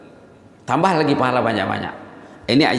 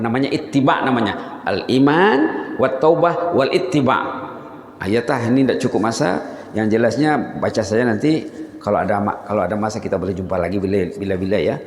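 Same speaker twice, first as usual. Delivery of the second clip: ordinary delivery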